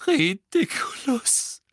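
high, slow sobbed spell; sobbing. A narrator's reading. Mrs Weasley sobbing the spell at the boggart. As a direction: choke the word through sobs. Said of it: "schluchzte Misses Weasley".

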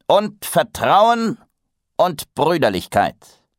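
slow, high, loud grandiose tone; pompous proclamation. A narrator's reading. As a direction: stretch out each lofty word. Master adds solemn ideals.

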